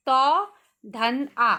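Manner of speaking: each sound said slowly and apart; slow sound-by-sound spelling. Shown as a demonstration. Teacher to children, breaking a word into sounds slowly. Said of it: "इसमें चार ध्वनियाँ हैं".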